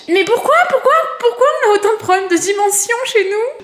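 high laughing exasperation. Real, not acted. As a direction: laugh through the complaint. Laughing at tiny delivered furniture.

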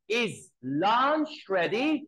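"Is lunch ready?" asked slowly with a rising end; asking tune. Shown as a demonstration. Teacher models asking mom for lunch, slow rising question. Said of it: "لو هقولها على شكل سؤال".

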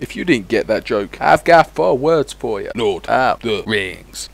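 normal talking voice

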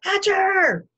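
high, full-force bellow; booming. A narrator's reading. Guest summons the host in outrage, booming. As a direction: boom the name across the table. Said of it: "Mister Yarby boomed".

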